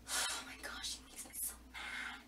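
breathy whisper of annoyance; whispered frustration. Real, not acted. Fan confiding anger at the heroine, in a whisper. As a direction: whisper it like a secret grudge. Fuming about a character.

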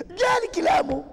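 preacher pleads with an imagined hothead; crying shout. Acted. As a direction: burst out in protest.

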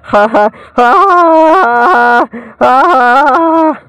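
slow high-pitched cackle; high slow laugh. Real, not acted.

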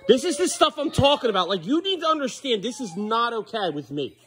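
high-pitched, incredulous telling-off; exasperated scolding. Real, not acted. Scolding over spilled milk.

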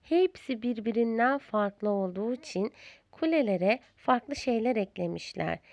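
ordinary delivery, nothing marked

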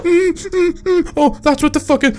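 high-pitched frantic squealing; panicked. Real, not acted. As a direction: whimper and yelp in real panic. Panicking, stuck while being chased.

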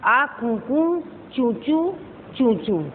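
slow, playful chirp like a little bird; cheerful imitated bird call. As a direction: chirp it out for the children. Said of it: "चिड़िया क्या करती हुई आई?".

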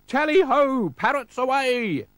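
high, loud rallying whoop; pirates' hunting cry. A narrator's reading. Pirates race after the dog, high loud cry. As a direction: whoop the chase into motion. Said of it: "cried the pirates".

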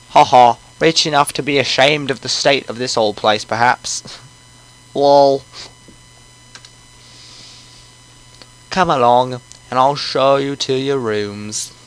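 put-on genial old British host; British old man, drawing out the ends; British accent. Old man shows guests to rooms, British accent. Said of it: "He's gonna have a British accent".

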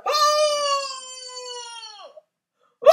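long re-enacted howl; travailing howl. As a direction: wail like a woman in labour. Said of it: "howled like a woman in travail".

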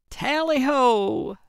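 high, drawn-out rallying call; stretched, gleeful hunting call; triumphant cry. A narrator's reading. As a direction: cry it out slowly with gusto. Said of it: "Gertrude cried".

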